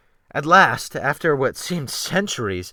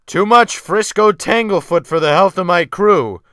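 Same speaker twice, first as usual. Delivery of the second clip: loud, higher jeering call across the water; mocking shout; 6 semitones higher, 10 dB louder than usual. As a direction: shout a mocking joke to the other boat. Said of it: "Wolf Larsen shouted after".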